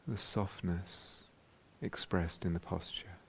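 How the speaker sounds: unhurried meditation voice with long pauses; guided meditation. Real, not acted. Teacher guiding meditators into whole-body awareness, calm and spacious. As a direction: guide them calmly, leaving space after each phrase.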